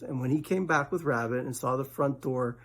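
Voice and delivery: plain reading voice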